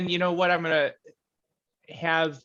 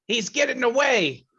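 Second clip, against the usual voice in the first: high, loud urgent shout; Clave's shout; 12 semitones higher, 9 dB louder than usual. Acted. Player as Clave warning that he's getting away, shouting. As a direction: shout the alarm at a raised pitch. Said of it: "Clave's gonna shout".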